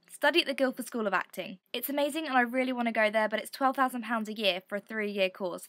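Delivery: ordinary talk, nothing marked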